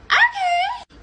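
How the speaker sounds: very high, squeaky acted reply; hesitant naive agreement. Sheltered woman agreeing unsurely, high squeak. Acts out her naive consent.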